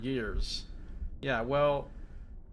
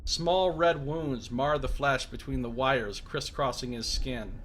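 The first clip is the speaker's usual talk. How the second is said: ordinary delivery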